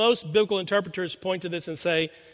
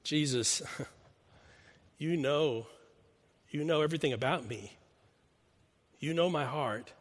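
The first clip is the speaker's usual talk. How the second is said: soft, humble admission; a humbled disciple answering his teacher, soft and sincere; humble confession; 5 dB quieter than usual. Retold or imitated. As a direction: quietly lay your heart open. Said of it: "This is a different Peter".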